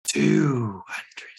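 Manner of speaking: eerie whispered haggle; creepy whisper. Acted. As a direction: whisper the counteroffer. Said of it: "in their creepy voice".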